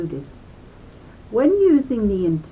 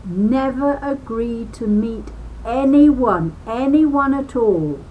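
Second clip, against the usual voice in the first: anyone, anyone punched louder, at all stressed; 3 semitones higher, 4 dB louder than usual. Shown as a demonstration. Reading a safety booklet to children, stressing anyone. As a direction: hammer the word anyone. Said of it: "I will repeat that".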